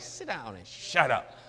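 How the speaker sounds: low, soft, slow dismissal; muttered put-down. Acted. Listener to a pompous praying preacher, muttered put-down. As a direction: drop it low under your breath, unimpressed.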